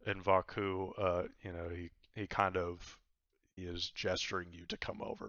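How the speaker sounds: ordinary talk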